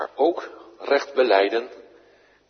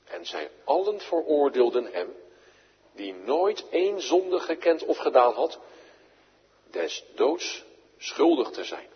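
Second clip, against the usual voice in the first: ordinary delivery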